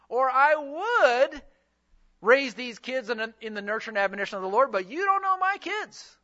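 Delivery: mock-exasperated parent making excuses, pitch jumping up at the end; protesting excuse.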